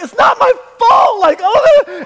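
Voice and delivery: high, loud hammed-up yelp; mock panicked shout. Preacher as baby flies at him, mock panicked shout. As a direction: cry out in comic panic, very high.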